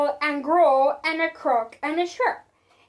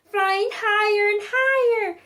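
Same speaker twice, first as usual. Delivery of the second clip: plain reading voice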